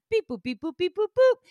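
singsong beeps jumping high at the end; imitated computer beeping. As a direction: mimic a machine processing, rising sharply. Imitates phone system working.

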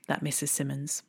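ordinary delivery

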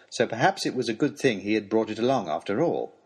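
ordinary delivery

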